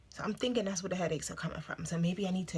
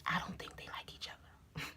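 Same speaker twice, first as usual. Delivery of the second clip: much softer, confiding whisper; whispered aside; 3 semitones lower, 11 dB quieter than usual. Real, not acted. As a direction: whisper it like gossip. Confides gossip, then says it aloud.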